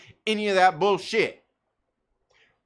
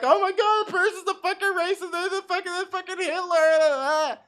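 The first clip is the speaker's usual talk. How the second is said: shrill, much higher mock panic; mock outrage; 11 semitones higher than usual. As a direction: ham up a hysterical offended person. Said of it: "I'm not sitting there like".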